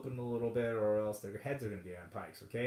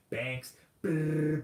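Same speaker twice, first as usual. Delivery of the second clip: lips buzzing brrr; money printer brrr; 4 semitones higher, 4 dB louder than usual. Pretend.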